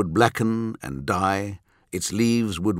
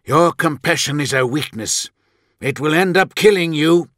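heated rebuke, louder and higher as it builds; angry, frustrated; 7 semitones higher, 6 dB louder than usual. A narrator's reading. Ferrus warning his brother, snapping in anger and frustration. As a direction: drive the warning home with rising anger. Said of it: "betraying his anger and frustration".

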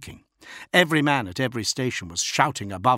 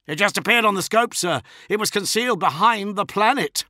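urgent report; 12 semitones higher, 5 dB louder than usual. A narrator's reading. Officer reports.

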